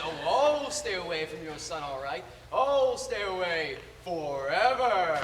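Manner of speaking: loud villain threat, long stretched ending; Sideshow Bob. Acted. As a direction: declaim it, swelling on a slow FOREVER. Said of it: "And then he says".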